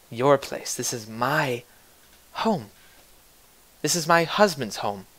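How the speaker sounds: very soft, measured threat, with pauses; quiet menacing woman. Acted. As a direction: threaten an intruder under the breath. Woman menaces intruder in her home.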